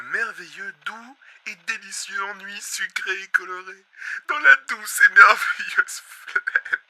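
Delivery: slow, savouring each word; dreamy reverie. Acted. Host dreaming aloud of blissful boredom. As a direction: linger lovingly over the words.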